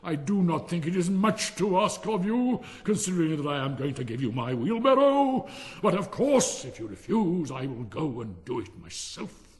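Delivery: loud, high, indignant miller; affronted miller. Acted. Miller reproaches Hans over the wheelbarrow, loudly affronted. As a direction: lay on the guilt loudly, easing at the end.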